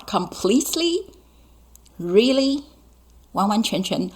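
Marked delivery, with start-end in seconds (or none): 0.1-2.6 mock doubt. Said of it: "teacher mocks doubtful listener".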